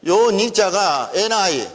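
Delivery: ordinary delivery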